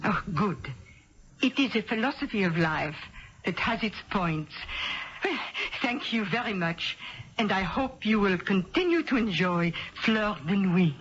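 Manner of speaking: put-on a Midwestern lady faking a French accent; thick put-on French accent, a bit overdone; phony French accent. Perfume rep posing as French, phony French accent. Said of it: "It was a phony French accent".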